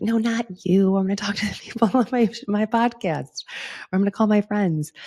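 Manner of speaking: playful brush-off. Retold or imitated. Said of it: "And I'm like".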